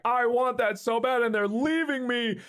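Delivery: high, pleading mock anguish; plaintive whine as the left-behind dog; dog's anxious thoughts. Owner voicing dog left behind, pleading distress. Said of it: "she feels like".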